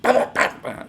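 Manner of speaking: loud, high mock gunshot volley; imitated gunfire. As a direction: spit out explosive shot noises. Imitating police gunfire again.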